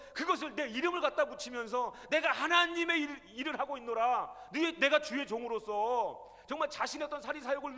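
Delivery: driving, emphatic pulpit voice; impassioned preaching. Retold or imitated. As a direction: preach God's grievance with mounting force. Preaches God's message at rising force.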